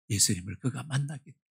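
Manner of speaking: ordinary talk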